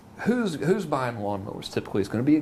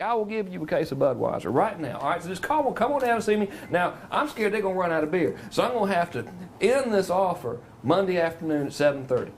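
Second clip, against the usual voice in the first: higher, fast, folksy Southern Georgia TV pitch; hammed-up Southern lawnmower salesman; 7 semitones higher than usual. Eddie opening his TV ad to local viewers, fast Southern twang. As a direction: play the small-town Southern mower dealer hamming it up for the camera. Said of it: "But they all talk like this".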